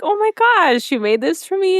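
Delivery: high, bright-eyed gush of delight; gushing excitement. Retold or imitated. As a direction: gasp it out like a touched friend. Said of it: "she's like".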